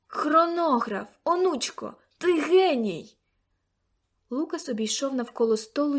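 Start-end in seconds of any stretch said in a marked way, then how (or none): 0.1-3.2 loud, slow, triumphant exclamation; excited outburst. A narrator's reading. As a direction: shout it like a sudden breakthrough. Grandfather's sudden triumphant idea.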